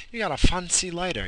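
playful mock-impressed tone; high mock voice.

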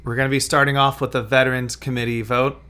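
usual speaking voice

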